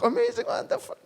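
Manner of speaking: a believer greeting the pastor, squealing in awe; gushing greeting. Pretend. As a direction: gush at the senior pastor in a high squeal. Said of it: "장로님".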